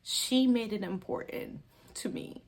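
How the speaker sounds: fighting back tears; getting emotional. Real, not acted. Telling viewers about a kind commenter, tearful. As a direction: voice thinning as tears come. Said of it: "I'm getting emotional".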